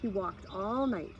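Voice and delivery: ordinary delivery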